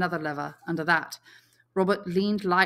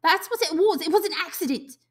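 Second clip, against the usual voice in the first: high and louder, insisting; defensive boy; 12 semitones higher, 7 dB louder than usual. Acted. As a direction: insist it was nothing. Piggy voiced high.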